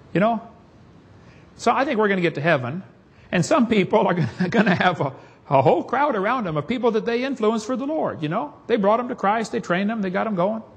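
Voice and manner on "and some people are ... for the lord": ordinary talk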